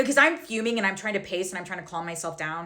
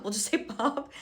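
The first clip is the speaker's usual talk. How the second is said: ordinary talk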